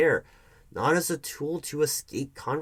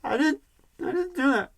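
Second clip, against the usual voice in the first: tearful; 14 semitones higher, 3 dB louder than usual. Acted. Accused partner near tears.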